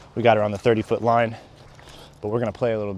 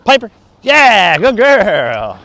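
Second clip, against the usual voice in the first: excited dog praise; 9 semitones higher, 10 dB louder, much slower than usual. Real, not acted.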